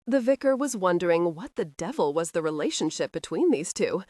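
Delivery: ordinary delivery, nothing marked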